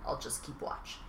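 whispered promise. Retold or imitated. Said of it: "and basically says".

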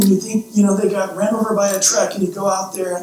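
ordinary delivery

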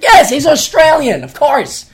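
high, loud mock exasperation; exasperated retort. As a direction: burst out as if it's obvious. Exasperated retort to girlfriend, retold.

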